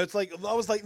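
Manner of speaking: ordinary talk, nothing marked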